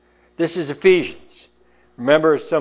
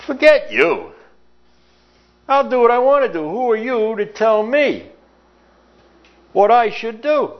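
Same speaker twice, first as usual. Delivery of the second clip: loud, high defiant snap at the priest; mock teenage defiance, pitched up and loud; defiant retort; 9 semitones higher, 3 dB louder than usual. Johnny snapping back at the scolding priest, loud and high. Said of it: "he looks up at the priest and he says".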